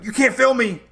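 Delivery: high, loud snippy imitation of her refusal; mocking the campground lady. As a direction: play her as a petty gatekeeper snapping back.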